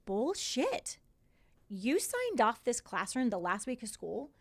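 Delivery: retold outrage, pitch swooping up on the swearing; indignant disbelief. Acted indignant reaction to sign-off sheet.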